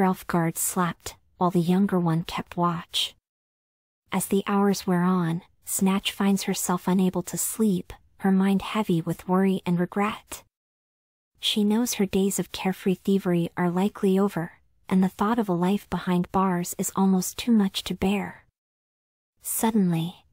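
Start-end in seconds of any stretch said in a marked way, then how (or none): none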